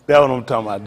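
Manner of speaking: ordinary talk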